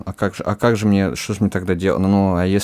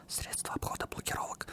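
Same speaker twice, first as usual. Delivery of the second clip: whisper; 20 dB quieter than usual. Real, not acted. Joking about evading blocks.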